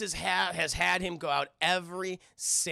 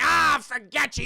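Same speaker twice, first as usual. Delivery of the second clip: high, loud dismissive outburst; acted exasperated yell; angry brush-off; 9 semitones higher, 8 dB louder than usual. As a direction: storm off mid-argument. Boy storms off from girl.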